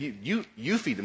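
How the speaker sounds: "You, you, you" hit hard, each word stressed; Jesus insisting, "you" pressed hard; emphatic you. Shown as a demonstration. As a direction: jab the repeated you at them. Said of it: "There's an emphatic emphasis there".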